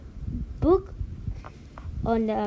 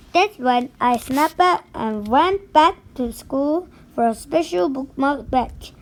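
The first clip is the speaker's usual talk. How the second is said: ordinary delivery, nothing marked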